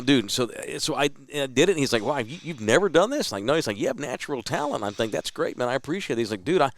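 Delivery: ordinary talk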